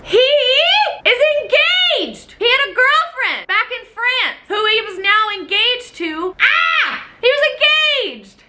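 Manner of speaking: high-pitched shocked outburst. Real, not acted. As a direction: squeal the news in disbelief. Shock at discovering engagement.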